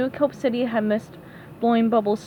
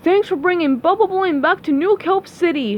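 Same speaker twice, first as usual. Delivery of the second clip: raised, high farewell thanks; shouting; 6 semitones higher, 4 dB louder than usual. Acted. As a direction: call out gratefully after them. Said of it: "shouted the new mayor".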